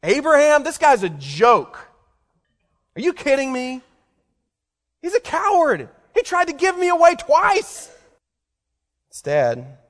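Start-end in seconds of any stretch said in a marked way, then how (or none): 0.0-7.9 higher and louder mock scorn, building to a shrill peak; hammed-up nagging wife tearing into her husband; mock belittling wife. Preacher mocking the wife Sarah never was, higher and louder. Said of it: "She didn't say".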